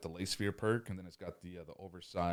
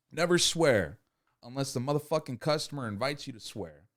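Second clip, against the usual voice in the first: ordinary talk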